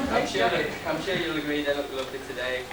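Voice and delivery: usual speaking voice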